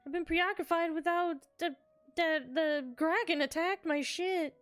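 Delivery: a hint of jittery nerves; nervous voice. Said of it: "nervous voice".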